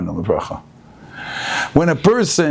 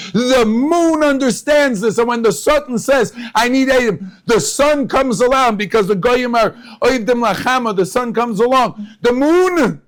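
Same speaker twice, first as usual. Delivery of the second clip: fiery preaching; 12 semitones higher than usual. Acted. Rabbi driving home the shofar's power to his listeners, fervent preaching. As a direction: preach it out with rising fervour.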